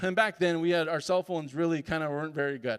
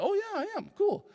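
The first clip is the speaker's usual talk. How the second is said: pleased surprise; 14 semitones higher than usual. Retold or imitated. Pleased at own prediction.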